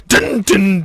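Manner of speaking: sung dun dun, rising high; mock dramatic sting. Mock TV reveal music while the camera zooms in.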